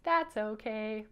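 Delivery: slow, drawn-out puppet catchphrase; accepting reassurance. Shown as a demonstration. Teacher leading kids to say it like Bouncer, slow and accepting. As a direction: stretch it out for kids to copy, easygoing. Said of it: "Can you say it like him?".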